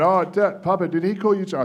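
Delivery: put-on a lightly done young boy asking his dad; young son. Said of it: "And then my son's saying".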